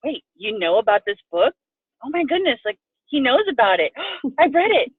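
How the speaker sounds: high, delighted disbelief; excited surprise. Retold or imitated. Retelling her thrill at his knowing the book, high and excited. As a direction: gush like you just found out he read it. Retold excited reaction to boyfriend.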